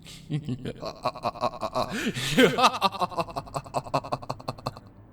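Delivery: long, maniacal cackle; evil laugh. Acted. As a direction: cackle with sadistic glee. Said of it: "The cult laughed".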